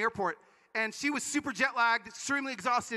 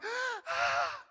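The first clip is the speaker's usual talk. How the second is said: shocked gasp. Retold or imitated. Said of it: "I'm freaking out".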